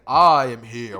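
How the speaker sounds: big heroic loud announcement; proud shouted hero arrival; booming entrance shout. Retold or imitated. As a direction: burst in bellowing like a hero. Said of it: "All Might would bust in through the door saying".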